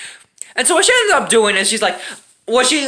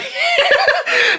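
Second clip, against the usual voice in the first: high burst of laughter. Real, not acted.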